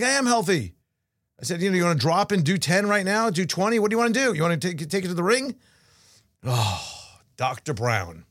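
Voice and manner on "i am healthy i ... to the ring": mock challenge. Speaker challenges doctor, retold.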